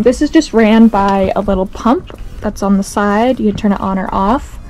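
usual speaking voice

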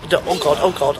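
ordinary talk

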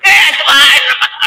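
strained high croak, slow and drawn out; scratchy dry throat. Shown as a demonstration. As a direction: croak it like a parched throat that had no water. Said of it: "వాడు ఏమన్నాడో తెలియదు, గీచు గొంతు". (ఎండిన గొంతుతో గరగరలాడుతూ, సాగదీసి)

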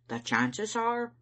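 stern and deliberate. A narrator's reading. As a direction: begin a grave warning, slowly. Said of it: "I said sternly".

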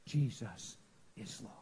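slow, low, reverent whisper; imitated whisper. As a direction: drop to a hushed whisper, word by word. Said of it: "Holy Spirit whispered to me".

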